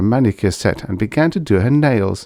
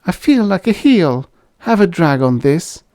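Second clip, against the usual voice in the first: guilty offer; 10 semitones higher, 4 dB louder than usual. A narrator's reading. Husband guiltily offers his cigarette.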